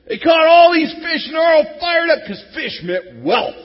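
fired-up excitement. Acted. Preacher tells congregation of huge catch, excited shout. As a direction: burst with the joy of the catch.

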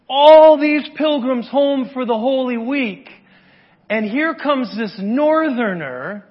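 loud, driving sermon voice; full-force preaching. Acted. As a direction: preach it out big to the whole room.

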